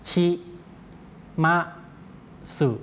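"ชิ มะ สึ" one syllable at a time, long gaps; slow syllable-by-syllable. Shown as a demonstration. Teacher leads viewers in pronouncing together, slow syllable-by-syllable "ชิ มะ สึ". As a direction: sound out "ชิ มะ สึ" slowly and separately. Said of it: "ออกเสียงช้าๆด้วยกันนะครับ".

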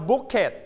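bucket in two syllables, "book-ket"; wrong extra syllable. Shown as a demonstration. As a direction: add a full "ket" to the end of book. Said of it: "we don't pronounce bucket". (bucket อ่านผิดเป็นสองพยางค์ "บุ๊ก-เค็ท")

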